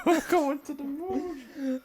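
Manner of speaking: high, slow, dreamy echo; high slow mock voice.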